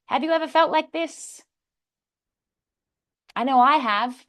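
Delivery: high, emphatic audience hook; opening speech question. Shown as a demonstration. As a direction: pose it big to the room, then answer it yourself. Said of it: "a speech with a question".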